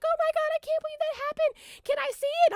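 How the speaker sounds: high, fast, loud squeal; excited fans. Retold or imitated. Fans tapping her to see it, excited squealing. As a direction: gush quickly in a high imitated voice. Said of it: "everybody's, like, tapping me".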